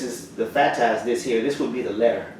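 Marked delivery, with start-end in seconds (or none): none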